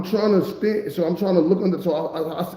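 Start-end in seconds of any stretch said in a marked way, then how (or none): none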